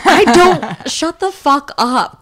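fed up, tell them off half laughing; annoyed outburst. Retold or imitated. Snapping at commenters about German Riesling, exasperated and loud. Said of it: "And I'm like".

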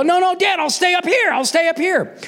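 put-on high-pitched pleading little boy; eager protest. Child hastily refusing dad's offer.

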